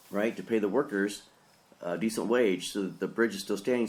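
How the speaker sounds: ordinary talk, nothing marked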